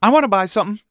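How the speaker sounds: put-on loud, high kid demand; clamouring kids. A narrator's reading. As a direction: blurt it like children just woken up. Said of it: "wake up with a collective".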